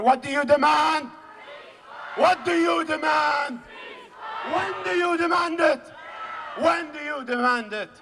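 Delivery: high, shouted rally cry; call-and-response shout. Real, not acted. Speaker calling on the rally to answer, shouted chant.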